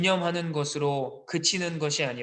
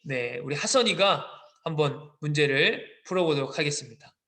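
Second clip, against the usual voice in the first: ordinary talk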